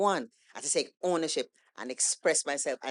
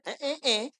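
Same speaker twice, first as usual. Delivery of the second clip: mock eeny-meeny counting; 9 semitones higher, 6 dB louder than usual. Acting out picking a poem.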